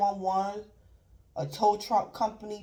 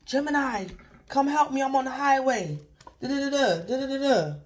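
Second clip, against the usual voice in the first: raised, hurried panicky plea; mock panicked caller; 5 semitones higher than usual. Stranded driver calls Gemini from the highway, mock panicking. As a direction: act a stranded driver begging for help. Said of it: "this person gonna call you panicking".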